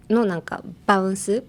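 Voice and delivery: normal talking voice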